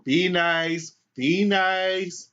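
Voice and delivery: calm the passengers down in a chanting caution; imitated bus driver scolding; warning bus driver. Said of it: "the bus driver's going".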